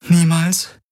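high-pitched, rising question; disbelieving echo. Acted. Woman questioning her partner's answer, high disbelief.

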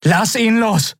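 captain ordering a release; commanding. Acted. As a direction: snap out a command to let go. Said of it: "befahl Endriel".

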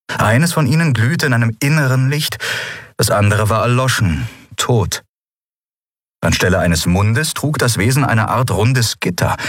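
usual speaking voice

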